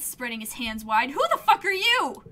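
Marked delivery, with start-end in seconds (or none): 1.1-2.2 high, loud indignant demand; angry shout. Real, not acted. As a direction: challenge him hotly. Player challenges commander.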